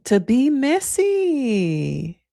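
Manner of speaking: put-on messy voice. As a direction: stretch it out long in the put-on voice. Put-on voice, first take.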